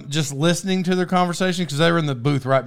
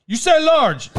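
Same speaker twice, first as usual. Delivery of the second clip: mock waitress; 12 semitones higher, 4 dB louder, slower than usual. Waitress checking order third time.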